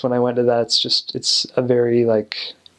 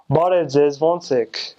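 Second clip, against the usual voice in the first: throaty Armenian accent; native Armenian accent; much faster than usual. Shown as a demonstration. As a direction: speak Armenian from the throat, like a local. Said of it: "but Armenians are like".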